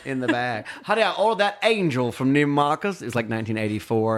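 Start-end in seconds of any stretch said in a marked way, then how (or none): 0.8-3.0 theatrical Southern grandmother; Swoopy bragging. Retold or imitated. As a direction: imitate a grand old lady proud of her purchase. Voicing Swoopy about her shopping.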